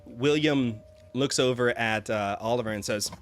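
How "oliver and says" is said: ordinary talk, nothing marked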